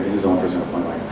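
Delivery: usual speaking voice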